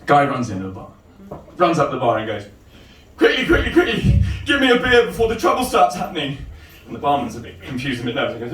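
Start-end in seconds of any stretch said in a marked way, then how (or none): 3.2-6.4 high-pitched urgent plea; urgent, high comic voice; frantic bar customer. Acted. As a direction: rush up to the bar in a panic. Acting frantic bar customer in joke.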